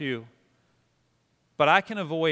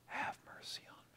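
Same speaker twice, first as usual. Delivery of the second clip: whisper; 26 dB quieter than usual. Shown as a demonstration. Pastor repeating breath prayer with congregation, whispered. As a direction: whisper it on one breath in, then out. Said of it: "Now whisper it again".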